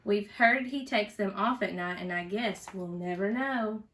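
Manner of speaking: usual speaking voice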